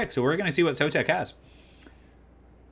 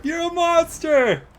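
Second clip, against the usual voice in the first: You're a monster! stretched slow and much higher; drawn-out yell; 6 dB louder, much slower than usual. Real, not acted. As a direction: drag out "monster" in a slow, giddy howl. Caster repeats, drawn out.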